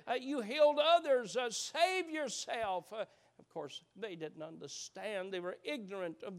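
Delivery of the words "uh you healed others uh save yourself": crying out in mockery. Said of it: "someone else cries out".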